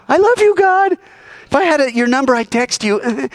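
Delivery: very high, lovey-dovey squeal; mock gushing. Preacher mocking someone blowing kisses at God, mock gushing. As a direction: gush it sweetly, hammed-up and high.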